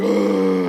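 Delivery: loud ragged gasp for air; gasping wheeze. Retold or imitated. Pastor imitating himself out of breath on a run, loud gasp. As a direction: heave like you're out of breath. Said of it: "deep gluttural noise".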